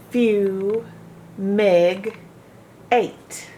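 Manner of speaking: "Fume", "Meg", "Eight" as separate beats with pauses between; syllable split. Shown as a demonstration. Teacher splitting "fumigate" into its syllables. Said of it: "closed in by the G".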